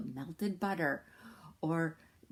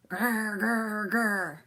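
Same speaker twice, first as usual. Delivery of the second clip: mock tiger growl, rolled r; 3 semitones higher, 4 dB louder, much slower than usual. A narrator's reading. The tigers answer Sambo with a mock growl. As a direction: growl back like a cross tiger. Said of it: "the tigers only answered".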